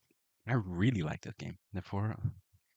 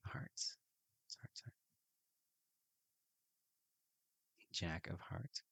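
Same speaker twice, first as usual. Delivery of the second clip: breathy whisper of the card name; hushed card reading; 13 dB quieter, slower than usual. Real, not acted. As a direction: whisper it like a spell. Turning cards, eerie muttering.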